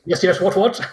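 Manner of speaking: lightly voiced British military type; a hint of a blustering army officer. Player voicing the town guard, light military impression. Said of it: "it ain't half hot mum military kind of".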